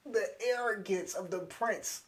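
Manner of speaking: giddy, emphatic admiration; fan gushing. Retold or imitated. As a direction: savour it like a delighted fan. Gushing fan reaction to the taunt.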